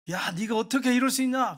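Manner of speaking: high, loud scolding outburst; angry scolding. Retold or imitated. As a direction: act out a father blowing up at his daughter. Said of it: "그러면서 야단을 막 쳤어요".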